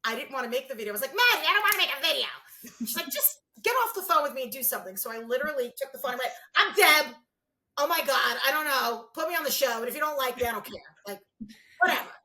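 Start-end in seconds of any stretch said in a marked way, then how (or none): 1.1-2.3 high, loud fast whine; whining. Retold or imitated. Whining refusal to Maddie. 3.1-5.0 impatient order. Retold or imitated. Maddie ordering her. 6.5-12.1 loud, brash don't-care rant; bitchy rant. Retold or imitated. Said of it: "I just, like, bitched at them".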